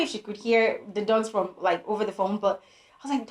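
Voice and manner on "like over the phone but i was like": usual speaking voice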